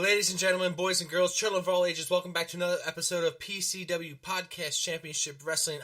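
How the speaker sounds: booming ring announcer intro; grand entrance announcement. Acted. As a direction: announce it like a big arena entrance. Host opens show like ring announcer.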